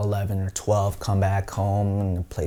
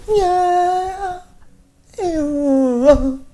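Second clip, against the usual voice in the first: high, drawn-out wailing cry; imitated wailing; 5 dB louder than usual. Teller mimicking the dawn call, high wail. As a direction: mimic a far-carrying chant, badly.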